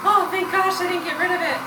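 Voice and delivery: high, loud relieved gasp of thanks; relieved exclaim. Retold or imitated. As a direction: blurt it out, relieved. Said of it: "I was like".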